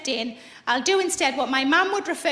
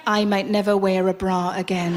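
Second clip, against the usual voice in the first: much lower, dreamy and limp; floppy relaxed daze; 11 semitones lower than usual. Retold or imitated. After a massage, dreamy and limp. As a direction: let it melt out post-massage. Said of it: "and I'm like".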